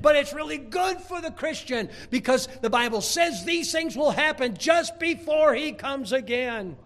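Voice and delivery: loud, high, slowing on key words; full-force preaching. Acted. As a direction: preach it out to the back row.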